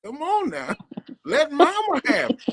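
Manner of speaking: high coaxing mama voice. Retold or imitated. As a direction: coax like a mother. Acted mama voice in story.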